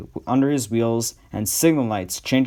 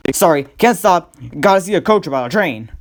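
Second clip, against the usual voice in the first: fast, higher rush; hurried; 5 semitones higher, 3 dB louder than usual. Acted. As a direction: blurt it out fast. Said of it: "he went on hurriedly".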